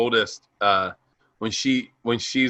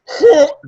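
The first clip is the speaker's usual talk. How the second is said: sudden loud high mock bawl; imitated crying burst; 9 dB louder than usual. Daughter suddenly breaking down, mock bawling. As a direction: burst out in an exaggerated wail.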